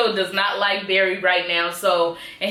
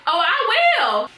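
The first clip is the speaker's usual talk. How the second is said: mock menacing; 8 semitones higher, slower than usual. Caitlyn's threat, voiced.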